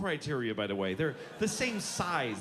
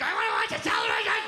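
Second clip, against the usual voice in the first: loud, high, fast bratty shout; whining kid shout; 7 semitones higher, 8 dB louder, faster than usual. Retold or imitated. Kid denied TV, a loud, high, fast bratty shout. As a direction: yell it like a kid robbed of TV. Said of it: "the kid's like".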